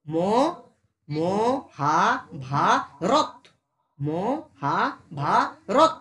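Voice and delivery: মহাভারত very slowly as ম-হা-ভা-রত, halting on ত; stopped final consonant. Shown as a demonstration. Teacher showing where breath gets blocked, syllables drawn out then stopped. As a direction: hold each open syllable, cut off at রত. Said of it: "থেমে যাচ্ছি, রথ".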